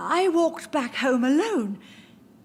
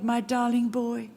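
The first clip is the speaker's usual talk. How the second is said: ordinary delivery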